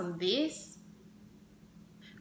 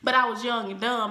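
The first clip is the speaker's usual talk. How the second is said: usual speaking voice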